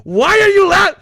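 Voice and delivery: very high, loud squealed protest; indignant outburst. Real, not acted. Mock outrage at being laughed at.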